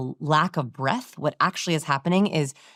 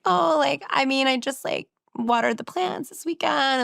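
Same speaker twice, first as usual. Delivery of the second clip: high, creaky, breathless valley-girl mimicry; vocal fry imitation; 9 semitones higher than usual. Coach mimics anxious daters' fried, lilting voice. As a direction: imitate a timid speaker's fried voice. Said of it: "they go into a pattern like this".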